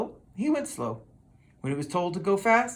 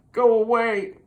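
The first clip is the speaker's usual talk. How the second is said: high, loud snapping dismissal; furious milkman; angry go away; 6 semitones higher, 8 dB louder than usual. Acted. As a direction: shoo him off angrily. Angry milkman fires Robert.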